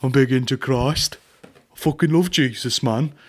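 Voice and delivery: lightly put-on accent, mocking piety; a hint of a hammed-up convert; mock reformed gangster. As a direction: imitate a tough guy turned churchgoer. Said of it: "we don't want the fucking".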